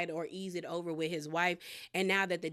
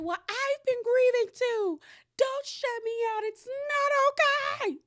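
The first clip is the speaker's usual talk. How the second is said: high, loud, slow imitated scream; imitating a screaming sister; 5 dB louder than usual. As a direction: mimic her shrill phone scream, dragging it out. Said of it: "her screaming on that phone".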